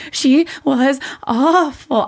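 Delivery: slow, high, crying voice; reliving a panic attack on the phone, slow high sobbing; sobbing retelling. Retold or imitated. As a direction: choke it out slowly and high like you're breaking down. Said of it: "I was like".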